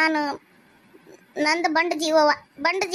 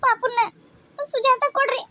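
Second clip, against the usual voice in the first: higher voice broken with crying; sobbing plea; 6 semitones higher than usual. Acted. Daughter-in-law hands over the baby, crying. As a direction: beg through tears that the child be well.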